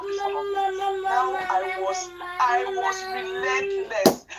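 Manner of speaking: worked-up crying shout. Retold or imitated. Furious retelling of the scam.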